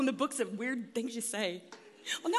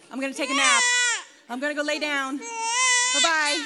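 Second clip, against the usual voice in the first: high, loud sing-out on the way off; gleeful escape; 10 semitones higher, 6 dB louder than usual. Pretend. Mother leaving aunt with baby, cheerful escape. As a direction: call it out as a comic getaway.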